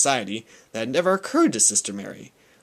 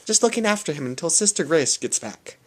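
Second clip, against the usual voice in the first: flustered nun; 10 semitones higher than usual. Acted. Nun covering up baby swap.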